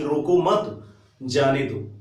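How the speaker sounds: slow "रोको मत", a break, then "जाने दो"; group "रोको मत" together and hold before "जाने"; pause after मत. Shown as a demonstration. Teacher explains that he may go, pausing after "मत". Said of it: "आशय यही हुआ कि".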